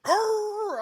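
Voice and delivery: loud, high-pitched dog "arr"; imitated dog noise. Owner acting out the startled dog, high loud yelp. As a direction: let out a startled dog's cry, high and loud. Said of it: "Then he was like".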